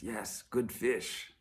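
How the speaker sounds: slow, easy-going fisherman; unhurried and content, a lightly voiced fisherman; calm fisherman. Fisherman answers the businessman's praise slowly and contentedly.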